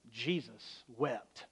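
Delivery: a reverent hush over "Jesus wept"; slow hushed reverence. Acted. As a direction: let each word of "Jesus wept" sink in, soft and slow. Quoting the short verse.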